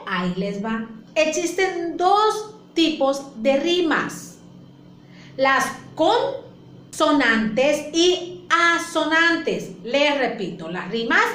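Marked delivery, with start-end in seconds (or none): 1.1-9.7 slow, stressed teaching. Shown as a demonstration. Teacher slowly stresses key terms.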